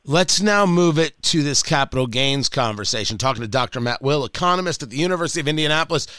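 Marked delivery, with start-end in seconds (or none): 3.2-6.1 fast, offhand repeat of the guest's name and title; rushed reintro. Real, not acted. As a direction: rattle it off quickly, like a familiar station ID. Host re-introduces guest, rushed.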